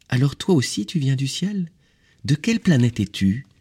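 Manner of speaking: put-on high, curious child-like questions; little prince. A narrator's reading. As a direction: ask eagerly as a small boy.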